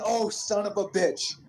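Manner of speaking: a startled oath, pitch jumping up; surprised curse. Real, not acted. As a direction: caught off guard, blurt it out. Sudden Batista attack in game.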